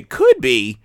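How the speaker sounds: loud, punched "could be"; pointed echo. Retold or imitated. Host interrupts co-host to quote the hedge, pointedly. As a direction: throw the words back loudly. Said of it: "interrupt you to say".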